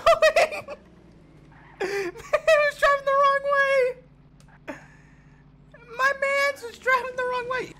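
squeaky, slow laugh-crying; high laughing squeal. Real, not acted. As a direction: squeal it through tears of laughter. Laughing at wrong-way driver.

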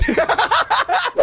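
high cackling laugh; burst of laughter. Real, not acted. Laughing that he didn't make it.

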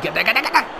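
high, urgent "get there" chant; will them to reach the ball; cheering players on; urging shout. Real, not acted.

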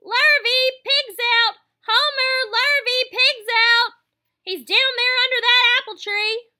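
farmer's wife yelling for Homer, very loud and high; shouting for the men. A narrator's reading. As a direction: holler out the kitchen window. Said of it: "immediately shouted for the men".

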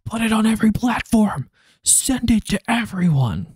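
high, hammed-up put-on voice; mock-hysterical call to spread it; frantic mock sharer. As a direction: cry it out high, stretching the last words. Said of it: "they would be like".

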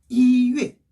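"이" high, level and loud before "회"; first-tone 이. Shown as a demonstration. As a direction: hold "이" high, then drop "회". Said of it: "일 성으로 발음이 돼요".

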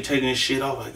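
ordinary talk

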